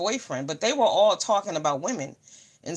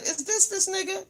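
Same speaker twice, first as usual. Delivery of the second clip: incredulous; 11 semitones higher, 3 dB quieter than usual. Retold or imitated. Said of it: "I look and I said".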